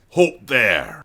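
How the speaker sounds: a stern halt from the guard captain; guard's command. Acted. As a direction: order them to stop, loud and firm. Guard leader halts the party.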